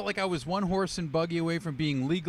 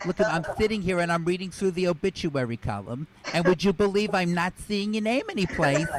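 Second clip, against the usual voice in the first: lightly voiced mother leaving a message; a hint of a chatty mom calling her son; mom on voicemail. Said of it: "listen to her messages like this".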